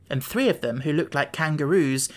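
ordinary delivery

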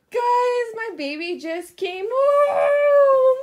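high, loud squealing with joy; overjoyed squeal. Real, not acted. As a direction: cry it out with joy. Mom's baby returns home.

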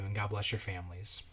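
whispered gentle goodbye; soft farewell. Real, not acted. Pastor blessing families at the end, whispered. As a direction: fade to a hush. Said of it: "closing blessing".

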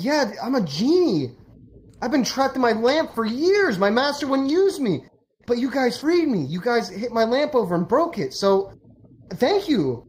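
high-pitched, gushing genie; acted elation, pitched well up; grateful freed genie. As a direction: pour out your thanks like a freed genie. Freed genie gushes his story.

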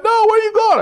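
high, loud mock wife asking; the wife calling after him; demanding wife. Wife insists.

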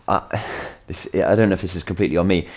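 ordinary talk, nothing marked

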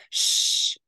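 sharp whispered shush; hushing. Acted. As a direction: hush him without waking her. Finger to lips, hushing butler.

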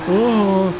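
sudden loud startled gasp. Real, not acted. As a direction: jolt of real surprise. Near miss with tractor.

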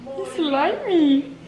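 speaking for the dog, high and slow; high, slow voice for the pet. Pretend. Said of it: "I'm gonna hate my dog voice".

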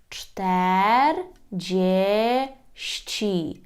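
czterdzieści drawn out very slowly, dziesi ending stressed; teacher drawing out czterdzieści; slow Polish model. Shown as a demonstration. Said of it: "Зверніть увагу на закінчення".

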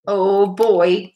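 mildly excited exclamation; a touch of boyish delight; exclaiming. A narrator's reading. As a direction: exclaim with understated glee. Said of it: "exclaimed Ralph".

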